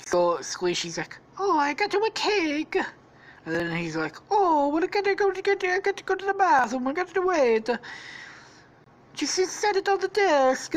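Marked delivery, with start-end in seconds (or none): 1.4-2.8 high, squeaky fake Italian; mock Italian accent. Squishy offering a cake, high mock Italian accent. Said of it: "Squishy's gonna be Italian too". 4.3-10.8 high, hurried cartoon Italian voice; mock Italian accent. Stampy needing the bathroom, hurried mock Italian accent. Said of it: "Stampy is actually Italian now".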